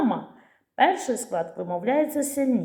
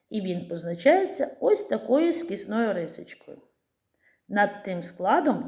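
ordinary talk, nothing marked

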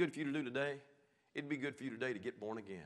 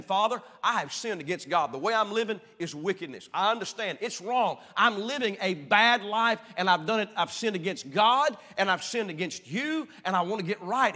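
higher, louder pleading repentance; earnest confession; 6 semitones higher, 11 dB louder than usual. Acted. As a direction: confess it to father with full feeling. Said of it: "he's saying".

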